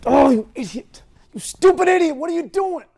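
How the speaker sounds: loud, high self-reproaching yell; anguished outburst. Retold or imitated. Owner finds the dog gone and blames himself, anguished shout. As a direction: explode at yourself in anguish.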